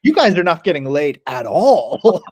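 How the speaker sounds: slow, high, drawn-out mocking voice; mock put-down. Newcomer to the sober flatmates, mocking put-down.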